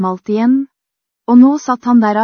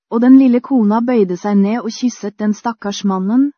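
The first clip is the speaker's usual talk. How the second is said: plain reading voice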